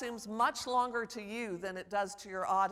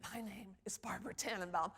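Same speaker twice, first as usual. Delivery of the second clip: softer, private self-talk; said to himself; 7 dB quieter than usual. Retold or imitated. Former student silently rehearsing before introducing himself, hushed aside. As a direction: keep it under your voice, for nobody else. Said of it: "he says to himself, so no one can hear it".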